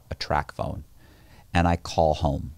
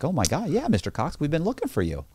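higher, falsely eager agent voice; mocking the FBI agent; 7 semitones higher, 4 dB quieter than usual. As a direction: parody her phony delight at finding him. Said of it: "And she's like".

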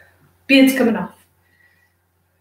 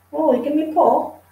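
ordinary talk, nothing marked

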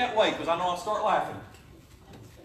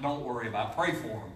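calm reply; 10 semitones lower, 5 dB quieter than usual. Retold or imitated. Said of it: "I'm like".

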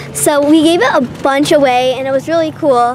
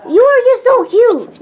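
high, loud, slow gushing coo; 8 semitones higher, 4 dB louder than usual. Real, not acted. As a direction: gush over the puppy. Cooing at the puppy.

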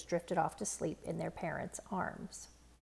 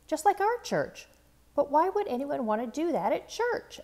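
much higher, louder disbelief; shocked child; 8 semitones higher, 6 dB louder than usual. A narrator's reading. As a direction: cry out in hurt surprise. Child shocked by the church shooting.

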